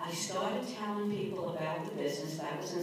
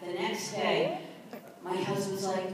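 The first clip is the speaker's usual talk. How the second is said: ordinary talk, nothing marked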